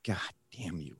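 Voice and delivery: very soft, defeated retelling; resigned mock curse. Retold crying mother gives in.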